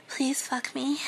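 high, whispered, desperate please; whimpering plea. Acted. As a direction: beg in a trembling whisper. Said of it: "I whimpered".

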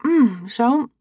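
muffled through chewing; mouth full. Acted. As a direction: chew and talk at once. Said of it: "I'm supposed to talk with my mouth full".

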